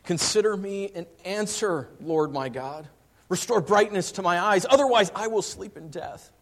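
preacher reading a psalm aloud; scripture reading. Real, not acted. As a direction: proclaim the psalm. Verse three. reading psalm.

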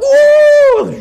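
a very high, loud whoop; mock hippie whoop. Prophets jumping around, very high loud mock whoop. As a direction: shriek it out like a jumping worshipper. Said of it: "and they're like".

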